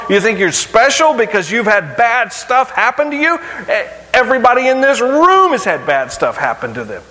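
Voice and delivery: rising fervour, pressing the congregation hard; preaching at full force. Acted. Preacher confronting the congregation, in fervent full-force preaching.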